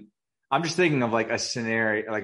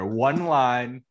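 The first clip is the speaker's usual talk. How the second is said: ordinary talk, nothing marked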